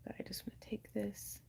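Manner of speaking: soft unvoiced whisper. Real, not acted. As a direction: whisper it while concentrating. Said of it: "whispering while cutting".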